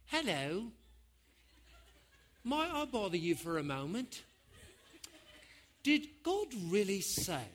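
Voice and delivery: soft, courteous tempter; polite serpent voice. Acted. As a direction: politely ask a small favour, softly. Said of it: "here's what that voice sounded like".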